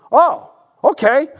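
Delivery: loud, high, drawn-out "oh, okay"; long high "ohh", then a relieved okay; sudden realization. Retold or imitated. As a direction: act out the penny dropping. Acting the man's sudden understanding.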